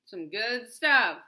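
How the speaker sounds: slow belched words; slow burp talk. Real, not acted. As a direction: burp through the words slowly. Eating and burping.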